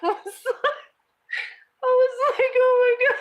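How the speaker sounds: high, laughing through the words; can barely get it out for laughing. Real, not acted. Laughing at son's antics.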